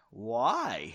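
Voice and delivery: low, mildly nervous question; understated nervous "why". A narrator's reading. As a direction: ask it with a touch of unease. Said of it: "Henry asked nervously".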